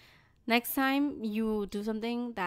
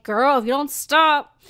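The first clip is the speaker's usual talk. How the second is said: louder, slower mock threat to a baby; play-scold the baby, drawn out; half-joking warning to a baby; 10 dB louder, slower than usual. Warning the baby hitting her.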